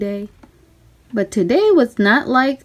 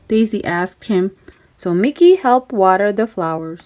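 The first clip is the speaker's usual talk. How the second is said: ordinary delivery, nothing marked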